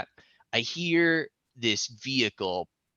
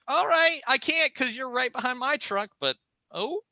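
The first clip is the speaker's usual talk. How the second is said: much higher, flustered; mock flustered yes; nervous agreement; 13 semitones higher than usual. Him agreeing to stay, high and flustered. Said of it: "And I'm like".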